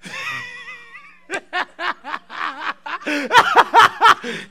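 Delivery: helpless high laughter in waves; laughing fit. Real, not acted. Preacher breaking up mid-offering, high building laugh.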